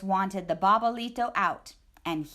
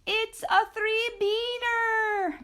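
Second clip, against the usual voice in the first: much higher, slow drawn-out announcement; high excited declaration; 13 semitones higher, slower than usual. Acted. The perritos declaring a three-beaner, high and drawn out. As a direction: proclaim the lucky beans. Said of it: "declared the perritos".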